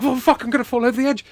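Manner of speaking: high, rushed burst of alarm; mock panic. Man pushed at the railing of a balcony, in retold panic. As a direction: blurt it out fast as if toppling over.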